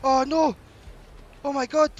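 high, loud cries of dismay; alarmed. Real, not acted. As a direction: panic as it gets worse. Player trapped in the game.

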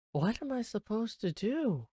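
high, soft plaintive question; quiet high-pitched helplessness; helpless bewilderment. Real, not acted. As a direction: ask it small and high, completely lost. Lost in the game, pleading.

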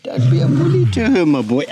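scolding. Real, not acted. Keeper telling his boy not to bully her, loud scolding. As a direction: raise pitch and volume to call off a bully.

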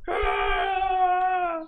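high cartoon death yelp; Yoda death scream. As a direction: squeak out a dying scream. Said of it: "Yoda dies ... and goes".

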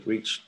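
REACHED with the final T popped after CH; explosive ending. Shown as a demonstration. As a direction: let the end of Reached burst like a tiny explosion. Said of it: "como un sonido de una explosión así".

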